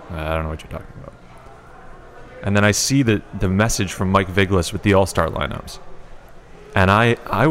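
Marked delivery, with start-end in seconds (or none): 0.1-1.1 dismissive. Retold or imitated. Friend texts bad news.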